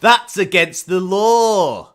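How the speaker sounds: slow, loud Scottish brogue; Scottish accent. Shown as a demonstration. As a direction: roll out law in a broad Scottish accent. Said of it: "this is why Scottish accents are better".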